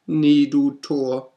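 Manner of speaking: high, loud, slow raven croak. Acted. Raven croaks its refrain at the narrator. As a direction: croak it out slowly. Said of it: "mit dem Krächzen".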